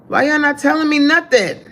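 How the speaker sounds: high-pitched, exasperated question; indignant surprise. Real, not acted. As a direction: call your viewers out in disbelief. Learns stream is glitching, complains.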